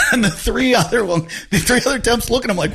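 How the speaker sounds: ordinary talk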